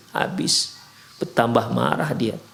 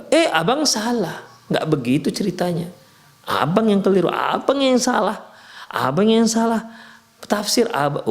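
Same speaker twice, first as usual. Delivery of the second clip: higher, sharp contradiction; defiant wife talking back; 6 semitones higher than usual. Acted. Wife arguing back at her angry husband, higher and defiant. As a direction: throw the blame back at him. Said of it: "Oh, bertambahlah jadinya".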